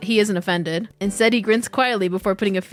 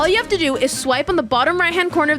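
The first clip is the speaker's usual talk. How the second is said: upbeat sponsor read; 5 semitones higher than usual. Acted. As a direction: sell it like a sponsor spot. Reads a sponsor ad.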